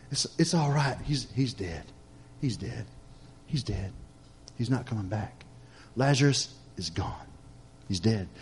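very soft and low, falling on each phrase; low, subdued murmur of finality; hushed resignation. Acted. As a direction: voice mourners who have given up, quietly, with pauses. Mourners give up on Lazarus.

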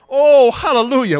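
loud, high hallelujah cry; shouted hallelujah. Real, not acted. Preacher crying out hallelujah to his church. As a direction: burst out praising God.

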